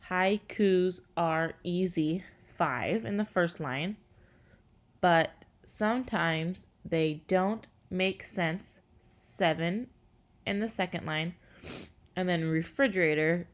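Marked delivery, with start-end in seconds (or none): 0.1-4.0 slow, syllable by syllable; counting syllables. Shown as a demonstration. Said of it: "if you count the syllables". 5.0-11.3 counting syllables. Shown as a demonstration. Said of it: "if you count the syllables".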